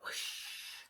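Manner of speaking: whispered wind whoosh. A narrator's reading. The wind replies.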